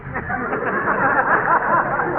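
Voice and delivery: a real, open laugh at the gag; hearty laugh. Real, not acted. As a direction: laugh freely, caught by the joke. Skinny laughs at the joke.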